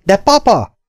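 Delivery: high, loud daughter's protest; kiáltás. A narrator's reading. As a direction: cry out to your father. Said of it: "kiáltott a lány". (magas hangon tiltakozva)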